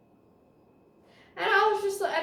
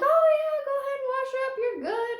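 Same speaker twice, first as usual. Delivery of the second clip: much higher, sweet and welcoming; sweet grandparents; 12 semitones higher, faster than usual. Retold or imitated. Grandparents inviting her to wash up, high and sweet. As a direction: coo it like kindly grandparents. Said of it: "they're like".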